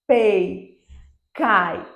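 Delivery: "pei", "cai", each one glide falling away; isolated "pei" and "cai", strong start, weaker tail; falling diphthong demo. Shown as a demonstration. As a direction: hit the vowel in "pei" and "cai", then let i drop. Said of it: "uma queda no meu som".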